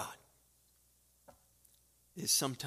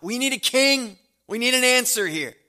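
raised pleading cry for a king; 9 semitones higher, 11 dB louder than usual. Retold or imitated. Preacher voicing the people demanding a king, loud cry. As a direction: call out loudly like a desperate crowd.

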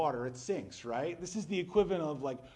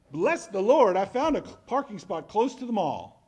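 loud, high mock praise; mock gushing post; 6 semitones higher, 10 dB louder than usual. Mocking a churchgoer's Facebook post, loud hammed-up praise. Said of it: "silly to go on Facebook and say".

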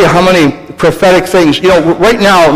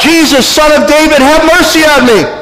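crying out; 11 semitones higher than usual. Acted. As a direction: call out to Jesus from the roadside, begging. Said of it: "he begins to cry out".

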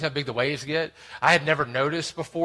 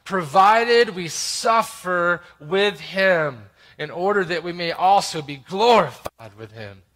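ordinary talk, nothing marked

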